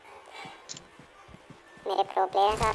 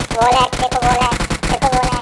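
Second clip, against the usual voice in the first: louder, higher gamer exclamation; raised, insistent in-game shout-out; excited callout; 4 semitones higher, 6 dB louder than usual. Real, not acted. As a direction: call it out urgently to teammates and viewers. Calling out a wall hack mid-game.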